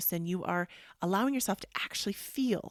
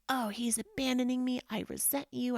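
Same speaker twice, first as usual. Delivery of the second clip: higher-pitched whiny complaint, imitated; mock resentful blame; 5 semitones higher than usual. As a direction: act out a wounded accusation in a raised pitch. Acting out blaming her husband.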